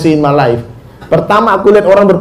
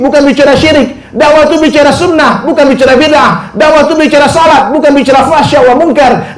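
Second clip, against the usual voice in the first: fiery preaching; 7 semitones higher than usual. Real, not acted. Preacher hammering home what da'wah should be, fervent. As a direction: preach it with building conviction. Preaching refrain at full force.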